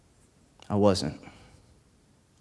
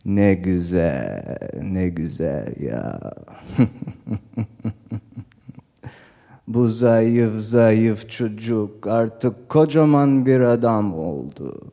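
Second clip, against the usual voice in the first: father on phone; 2 semitones lower, much slower than usual. Retold or imitated. Father to someone on the telephone about his son, slow impersonation. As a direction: voice a father drawling Turkish down the telephone. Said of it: "He's talking on the telephone".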